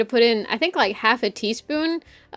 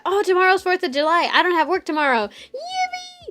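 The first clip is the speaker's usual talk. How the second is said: mock delight; 7 semitones higher than usual. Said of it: "I remember that".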